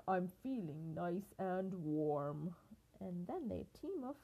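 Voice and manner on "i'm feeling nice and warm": slow, lower lion voice; storybook lion, low and drawn out; contented lion. A narrator's reading. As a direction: rumble it slowly as a lion. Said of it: "roared the lion".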